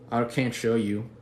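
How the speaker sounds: ordinary talk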